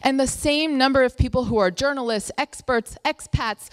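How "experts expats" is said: normal talking voice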